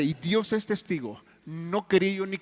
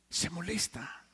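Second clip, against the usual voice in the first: repeated much softer, almost to himself; quiet echo; 10 dB quieter, slower than usual. Real, not acted. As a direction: let the repeat sink into near silence. Repeats, then drops voice. (repetición muy suave, casi para sí)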